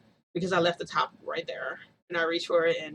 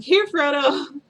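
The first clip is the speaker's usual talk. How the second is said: calling out; 9 semitones higher, 10 dB louder, much slower than usual. Retold or imitated. Calling baby with bottle.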